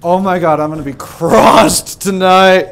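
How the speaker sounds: loud, high laughing outburst; excited. Real, not acted. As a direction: blurt it out, laughing. Exclaims loudly, laughing.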